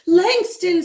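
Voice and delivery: calling the name out high and loud; shouted name. A narrator's reading. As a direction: shout across the crowd to him. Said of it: "someone shouts".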